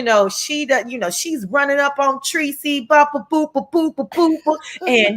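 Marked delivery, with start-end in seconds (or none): 2.8-4.5 scatting sound. Retold or imitated. Imitating running sound.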